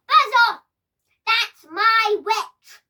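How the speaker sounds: roaring high and very loud, last words slow; terrible monster voice. Acted. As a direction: bellow it like a four-headed beast. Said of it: "its terrible voice".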